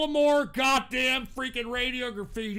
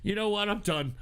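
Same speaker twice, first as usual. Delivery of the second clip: usual speaking voice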